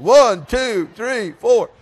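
high, loud game count. Retold or imitated. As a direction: shout the count for all players. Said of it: "he starts counting".

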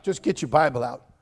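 loud firm mentor's voice; old preacher instructing. Retold or imitated. Old preacher directs the young preacher, firmly. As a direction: tell the boy firmly to open his Bible. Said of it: "And he said".

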